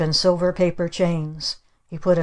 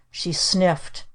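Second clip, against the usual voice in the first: ordinary delivery, nothing marked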